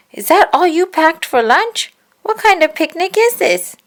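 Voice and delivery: high, loud indignant complaint; a high exasperated friend; indignant Susie. A narrator's reading. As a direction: scold him for packing badly. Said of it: "Susie said when she looked".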